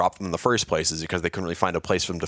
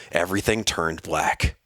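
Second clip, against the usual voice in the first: ordinary delivery, nothing marked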